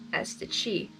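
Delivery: plain reading voice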